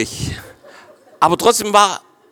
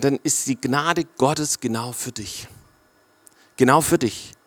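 tender reassurance; 3 semitones lower, 7 dB quieter than usual. Acted. Tender address to hurting listener.

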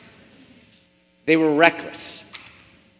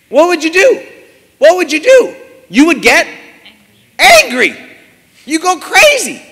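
high, loud, impassioned preaching cry; rising shout; 10 semitones higher, 9 dB louder than usual. Acted. Preacher demands an answer from the congregation, shouting. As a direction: hammer the question home at full force.

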